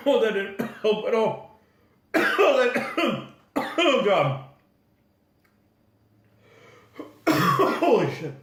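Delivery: choking coughs between strained words; coughing fit. Real, not acted. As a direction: cough through it, throat burning. Coughing fit after hot sauce.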